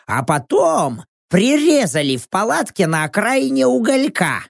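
high-pitched character voice; Rox, companion telling what they know. A narrator's reading. Rox tells his friend about one Piy, high character voice. Figure of Рокс in dialogue.

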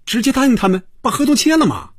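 agitated, very high-pitched rant; exasperated high yelling; shouting complaint. A narrator's reading. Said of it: "冲着于威嚷道".

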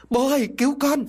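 put-on high, loud child's scream; screaming for help. A narrator's reading. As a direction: shriek for daddy from the water. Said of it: "hét lên".

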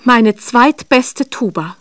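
drawn-out moan over the tuba; father's lament. A narrator's reading. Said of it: "sagte Lucys Vater".

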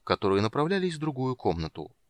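ordinary delivery, nothing marked

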